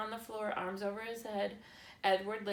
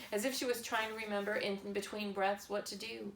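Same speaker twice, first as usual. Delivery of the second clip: usual speaking voice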